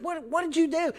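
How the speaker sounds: high-pitched, alarmed questioning; startled dad. Acted. Dad asking the boy what he did, startled. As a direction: question the child in sudden disbelief. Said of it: "my dad was like".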